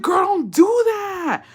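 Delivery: high, slow shocked cry; startled yell. Retold or imitated. Woman scolding the aunt, high shocked yell. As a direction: yell it from the gut. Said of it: "But the way I was like".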